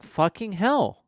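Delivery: slow, high-pitched child imitation; innocent little kid. Kid repeats swear for mum.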